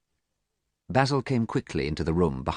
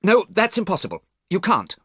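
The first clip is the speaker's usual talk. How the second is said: urgent; 13 semitones higher, 4 dB louder than usual. A narrator's reading. Dorian panics, refusing Basil.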